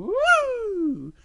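very high, drawn-out woo; sliding whoop. Acted. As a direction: whoop down the slide. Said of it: "And Little Bear's going".

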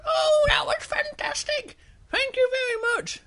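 extremely high, delighted little-bear squeak. Acted. Little Bear thanks the princess, squeaky delight. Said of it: "And Little Bear goes".

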